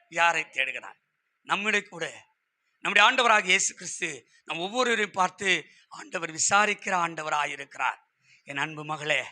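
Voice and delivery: soft, low, gentle preaching; tender consolation. Acted. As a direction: drop right down and speak tenderly to a hurting listener. Preacher consoles as Jesus would. (கனிவான, தாழ்ந்த மென்மையான குரலில்)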